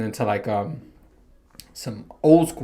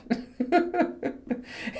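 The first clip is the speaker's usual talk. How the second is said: airy laugh; 13 semitones higher, 8 dB quieter than usual. Real, not acted. Laughs after saying he bombed.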